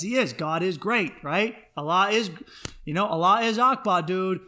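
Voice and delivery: usual speaking voice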